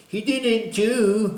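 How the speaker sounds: high, slow, mocking sing-song; mocking whiny voice. Mocking a coward who blames others, high whiny voice. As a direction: mimic a cowering coward's whine. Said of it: "he'll be like".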